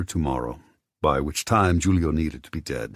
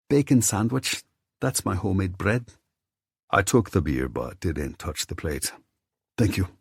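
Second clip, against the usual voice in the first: lightly voiced, higher Scottish landlady; 3 semitones higher than usual. A narrator's reading. Missus Campbell questions the narrator at the bar in a lightly higher landlady voice. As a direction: play the nosy, kindly pub landlady.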